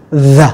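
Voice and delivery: "the" as a buzzing dental sound; voiced TH. Shown as a demonstration. Teacher adding voice to the TH, buzzing it. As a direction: say "the" with vibrating vocal cords. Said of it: "rung cái dây thanh quản lên".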